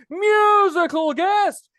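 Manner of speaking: high, loud, drawn-out big introduction; show announcer. Pretend. Host bringing on the musical guest, booming announcer. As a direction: announce the star act, stretching every word.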